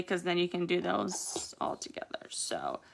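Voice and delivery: soft unvoiced whisper; whispered aside. Real, not acted. Nail tutor whispering an aside to viewers.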